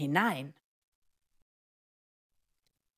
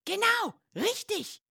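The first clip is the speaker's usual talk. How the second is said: very high, excited praise; delighted confirmation. Acted. Schoolbag confirms the right answer. (begeistert, ganz hoch bestätigt)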